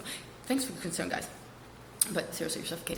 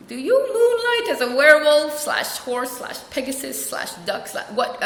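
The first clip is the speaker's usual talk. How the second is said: higher, drawn-out mock disbelief; incredulous questioning; 6 semitones higher, slower than usual. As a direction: question slowly, piling on each absurd guess. Coach baffled by animal words.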